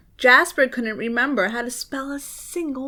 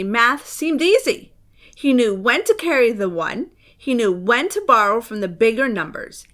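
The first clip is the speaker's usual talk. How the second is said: usual speaking voice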